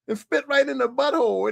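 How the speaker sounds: normal talking voice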